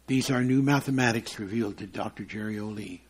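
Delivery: ordinary delivery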